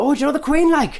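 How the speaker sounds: high mock Northern accent; Northern girl. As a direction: pipe up as an awed Northern girl, hammed-up. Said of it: "the accent you're doing does remind me".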